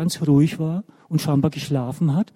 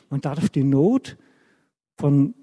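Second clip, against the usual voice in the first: usual speaking voice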